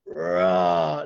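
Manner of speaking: low, drawn-out lion's roar; big lion roar. Acted. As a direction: let out a big, deep roar. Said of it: "lets out a big roar".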